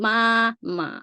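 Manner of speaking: "Ma" sagging low, as if depressed. Shown as a demonstration. Tutor giving students a memory trick, depressed low tone. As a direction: droop "Ma" like someone needing therapy. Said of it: "sound like you have depression".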